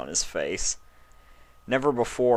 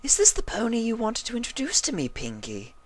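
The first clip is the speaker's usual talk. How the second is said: high, quick pony voice; curious, high question; high mare voice. A narrator's reading. Rarity asking Pinky about the stranger, high. Character voice.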